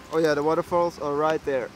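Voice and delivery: ordinary talk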